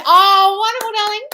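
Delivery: loud, very high, delighted squeal; excited gushing. Real, not acted. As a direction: gush over their good news. Congratulating a viewer on a manifestation.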